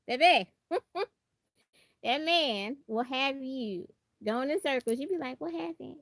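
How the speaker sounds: usual speaking voice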